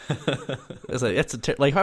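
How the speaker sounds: laughing while talking; words bubbling with laughter. Real, not acted. Laughing at absurd app idea.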